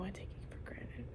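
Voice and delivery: hushed whisper, admitting it to herself; whispered confession. Real, not acted. Confessing to the camera in a guilty whisper. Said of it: "confessing quietly".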